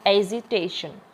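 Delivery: Agitation with stressed टे; teacher's careful agitation, leaning on टे; stress on TAY. Shown as a demonstration. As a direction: put the weight on टे in agitation. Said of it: "यहाँ पे भी station पे".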